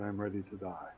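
soft, low, fading last words of a dying man; faint dying words. Retold or imitated. Dying pastor tells the neighbor he is ready, faint and fading. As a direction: let it fade out like a last breath.

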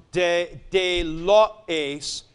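de lo ace said very slowly and deliberately; form read slowly. Shown as a demonstration. Teacher pointing out a misprint to students, slow. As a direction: read de, de lo ace slowly, each syllable clear. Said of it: "should have a iota subscript".

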